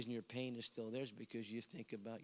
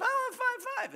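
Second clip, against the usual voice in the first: put-on very high, loud childish demand; whiny little girl; 11 dB louder than usual. Girl insists to the preacher on five five, high childish whine. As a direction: plead it like a spoiled child.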